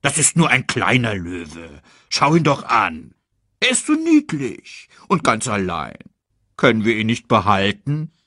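leicht verstellte, weiche Pumba-Stimme; gutmütiges Warzenschwein. Acted. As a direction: ein sanftes Warzenschwein bittet. Pumbas Figurenstimme. (dezent gespielter Pumba)

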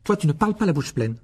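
dry, stern reprimand; curt retort. A narrator's reading. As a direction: cut him off sharply. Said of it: "répliqua sèchement madame Wesley".

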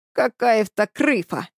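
lisping, slow, put-on voice; thick lisp on every s; slushy lisp. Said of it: "отозвался шепелявый голос".

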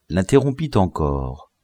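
ordinary delivery

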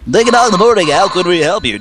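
high, punchy radio phone greeting; morning radio host. Pretend. DJ answering a listener's call, hammed-up and high.

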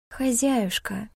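slow sweet purr; purring sweetly. A narrator's reading. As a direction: coax it tenderly, slowly. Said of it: "промурлыкала Дахут нежнейшим из голосов".

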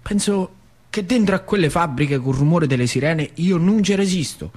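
normal talking voice

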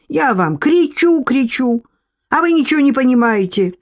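high-pitched acted protest; high, indignant character voice; exasperated complaint. A narrator's reading. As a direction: pitch it up and scold them. Exposed man berates his attackers.

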